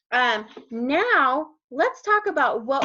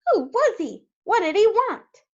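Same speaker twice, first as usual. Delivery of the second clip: high, urgent questions; flustered, raised voice; animals crying out; 10 semitones higher, 4 dB louder than usual. A narrator's reading. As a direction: fire off questions in bewilderment. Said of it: "the animals cried".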